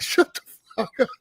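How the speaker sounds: high-pitched mock snap back; imitated outburst, pitch jumping up; retold angry retort. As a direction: re-enact telling off a parent. Retold yelling back at the father.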